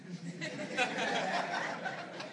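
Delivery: high laugh. Real, not acted. Laughing at own story.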